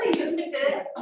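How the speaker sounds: ordinary delivery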